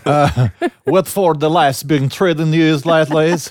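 thick mock Russian-Swedish accent, high and loud. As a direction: say it like a Swede who moved to Russia. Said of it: "you've been really into the Russian accent".

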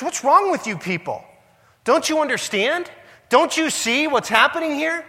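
high-pitched, exasperated pleading; mock freaking out. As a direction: plead like an alarmed outsider nobody listens to. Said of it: "he's freaking out".

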